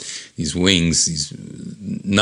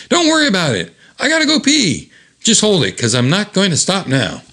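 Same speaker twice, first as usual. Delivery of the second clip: much higher cartoon voice; high squeaky voice; 11 semitones higher than usual. Van banter, very high voice.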